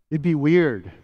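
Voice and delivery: ordinary talk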